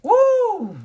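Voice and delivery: high, loud exasperated woo; exasperated whoop. Real, not acted. Reader reacting to an annoying card, high loud whoop. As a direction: let out a big frustrated whoop. Exasperated outburst over cards.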